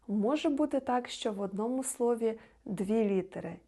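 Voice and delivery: normal talking voice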